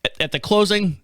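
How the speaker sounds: normal talking voice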